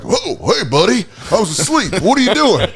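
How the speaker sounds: high, startled drowsy bum; hammed-up sleepy vagrant voice; homeless guy voice. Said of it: "we did this voice where he'd go like".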